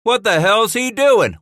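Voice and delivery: high, loud panicked cry; shrill frightened squeak. Acted. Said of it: "squeaked in a shrill, frightened voice".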